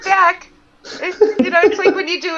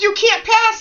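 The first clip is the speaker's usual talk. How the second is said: bossy team leader; 10 semitones higher, 6 dB louder, slower than usual. Retold or imitated. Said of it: "We're running the group".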